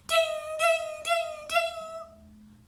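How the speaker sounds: very high sung dings; timer ding. Acted. The oven timer going off, high ringing dings. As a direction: ring like an oven bell.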